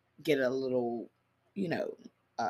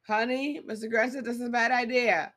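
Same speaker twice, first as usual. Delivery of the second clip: mock pleading wife; 8 semitones higher than usual. Mocking the wife's pleading line.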